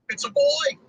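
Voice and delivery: put-on high, jokey "it's a boy"; mock birth announcement. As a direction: announce it like a proud new parent. Joke announcing pendant recovery.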